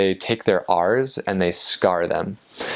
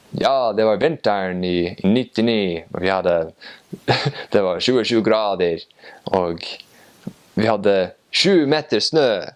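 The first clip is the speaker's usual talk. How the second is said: thick North Norway fisherman's dialect, lilting; old Northern Norwegian fisherman. Retold or imitated. Old man recalling winter's cold and snow, imitated Northern Norwegian fisherman's dialect. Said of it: "he had a very thick fisherman's dialect".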